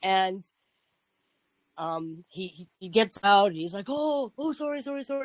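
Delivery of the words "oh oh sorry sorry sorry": high, soft imitation of apologising; flustered apology. Driver who hit a cyclist gets out, imitated flustered apology. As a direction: imitate someone hurriedly saying sorry in a raised pitch.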